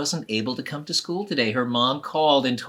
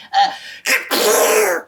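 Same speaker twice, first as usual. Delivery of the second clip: pre-sneeze gasp; 9 semitones higher, 7 dB louder than usual. Acted. Boy about to sneeze.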